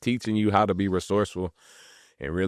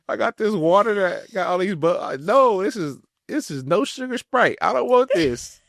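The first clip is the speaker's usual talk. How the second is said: laughing complaint; 13 semitones higher, 4 dB louder than usual. Retold or imitated. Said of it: "retold complaint about fizzy water".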